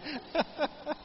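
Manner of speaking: high, choppy chuckle; amused laugh. Real, not acted. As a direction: laugh heartily at your own misfortune. Laughs at end of anecdote. (cười giòn)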